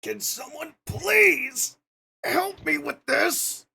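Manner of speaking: high, slow pleading shout; shouting for help. Pretend. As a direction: call out loud for help. Pinned under character, calls for help.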